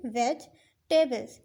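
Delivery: वेजिटेबल्स said slowly in two parts; split into parts. Shown as a demonstration. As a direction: break वेजिटेबल्स into two clear pieces. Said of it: "इसको हम दो parts कर लेंगे". (वेजिटेबल्स को दो हिस्सों में धीरे बोलना)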